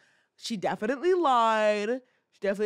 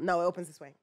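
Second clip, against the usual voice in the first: usual speaking voice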